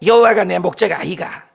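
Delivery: playful Gyeongsang-dialect Psalm for the congregation. Pretend. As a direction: read it like a Gyeongsang grandpa, in Gyeongsang dialect. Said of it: "경상도 사투리로 그랬대".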